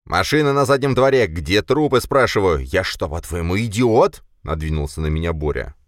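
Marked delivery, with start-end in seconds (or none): 0.0-4.2 loud, high, indignant demand; heated, accusing outburst; angry confrontation. A narrator's reading. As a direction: bear down on them angrily. Angry Boris confronts narrator.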